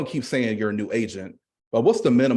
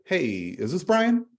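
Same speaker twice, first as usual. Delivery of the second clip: slow, higher polite ask; formal phone question; 8 semitones higher, slower than usual. Shown as a demonstration. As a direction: ask it like a stranger calling. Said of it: "you say".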